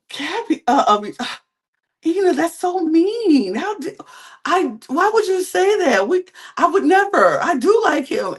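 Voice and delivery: high-pitched imitation of wounded innocence; hammed-up, high whine of someone caught out; mock indignant protest. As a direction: stammer and protest in a high, put-on voice. Imitating Sophia protesting when exposed.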